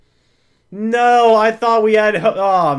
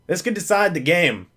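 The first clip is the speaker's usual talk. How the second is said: ordinary delivery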